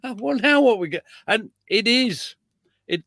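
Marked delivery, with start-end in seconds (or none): 0.0-1.0 higher, hammed-up dismay at the tanks arriving; mock alarm. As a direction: play the startled officer for laughs. Mock panic at German armor arriving.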